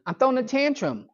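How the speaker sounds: high-pitched imitation of a sulking boy; tantrum kid. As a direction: play the kid announcing his tantrum. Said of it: "he starts the tantrum part".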